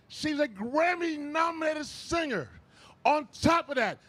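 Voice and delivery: ordinary delivery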